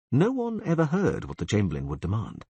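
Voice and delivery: ordinary delivery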